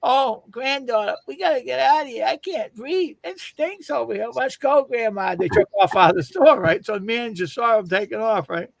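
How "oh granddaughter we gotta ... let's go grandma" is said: high, put-on old lady voice; old lady fleeing the smell, voiced high. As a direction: pitch it up like a fussy grandma. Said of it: "She says".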